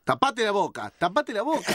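high, urgent repeated order; urgent scolding. Real, not acted. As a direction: scold him to cover up. Scolding sneezer.

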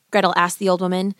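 ordinary delivery, nothing marked

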